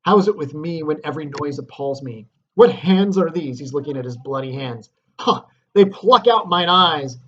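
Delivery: stare at your bloody hands in horror; guilt-stricken Macbeth. Acted. Dramatic reading of Macbeth.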